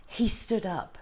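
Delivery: plain reading voice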